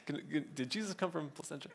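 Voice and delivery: a hint of a snooty Brea local, murmured fast; mock snooty local. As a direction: toss it off like a snobby neighbour, half under the breath. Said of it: "if we're snooty Brea people, be like".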